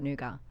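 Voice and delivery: Nougat with the T left silent; Australian pronunciation. Shown as a demonstration. Guest teaches how Australia says it, nougat with silent T. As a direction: say nougat as "noo-gah". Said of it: "the T is silent in Australia".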